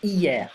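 Hier said as ee-yair, no H at all; silent H. Shown as a demonstration. Teacher shows students Hier with a silent H. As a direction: drop the H in Hier, start on the vowel. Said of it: "I don't pronounce the H".

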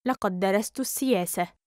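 usual speaking voice

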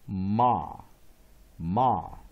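"ma" with an open ah vowel; short-O A. Shown as a demonstration. Teacher drilling the strong last syllable, loud open "ma". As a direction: say "ma" loud and firm, with short O's ah. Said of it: "adds short vowel O".

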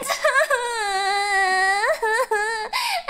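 high awkward giggle; embarrassed laugh. Real, not acted. Embarrassed laugh after singing.

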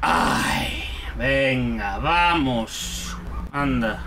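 low, weary self-coaxing; resigned sigh. Real, not acted. Player reluctantly carries on, low resigned sigh. Sighs, gives in and continues.

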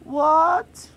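loud, high-pitched indignant yelp; shrill, affronted outburst; outraged disbelief. Acted. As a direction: burst out in shocked protest. Outraged at being left out.

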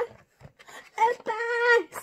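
high, restrained storybook scream; mock scream. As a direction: squeal it in pretend terror. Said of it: "screamed Eddie".

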